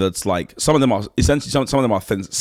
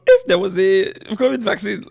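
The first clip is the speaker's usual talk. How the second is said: mock Nigerian accent; 11 semitones higher, 4 dB louder than usual. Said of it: "You, you go".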